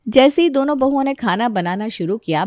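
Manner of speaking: plain reading voice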